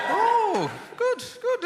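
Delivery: high pleased exclaim; delighted surprise. Real, not acted. Comic hearing Irish in the crowd, high delighted surprise. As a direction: jump up delighted.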